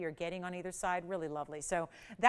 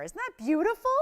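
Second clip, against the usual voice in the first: delighted exclamation; 9 semitones higher, 6 dB louder than usual. Real, not acted. Host marvels at ring colors.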